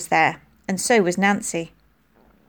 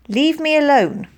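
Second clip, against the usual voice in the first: angry; 7 semitones higher, 4 dB louder than usual. A narrator's reading. Anna refusing.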